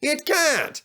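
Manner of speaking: high, insistent contradiction; stubborn denial. Acted. Sir Handel arguing with Rheneas, high stubborn denial. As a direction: dig in and refuse to believe it. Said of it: "A noisy argument started".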